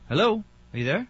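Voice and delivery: calling out. Real, not acted. Host calling to silent caller.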